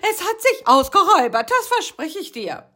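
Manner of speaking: very high, loud begging; pleading. Acted. Rocky begging Karl-Heinz for mercy, high pleading. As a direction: beg for mercy, whining. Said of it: "flehte er".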